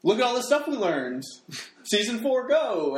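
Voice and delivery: high, bright mock hype; hammed-up cheerful recap; mock excited announcement. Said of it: "they're gonna be like".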